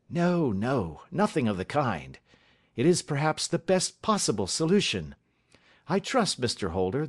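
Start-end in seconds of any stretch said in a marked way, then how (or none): none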